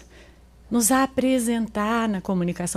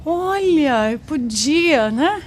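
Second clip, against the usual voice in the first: slow, drawn-out mock admission; lightly acted reluctant climb-down, stretching the words; sheepish concession; 2 semitones higher, much slower than usual. Wife realising she could have spoken up, sheepishly conceding.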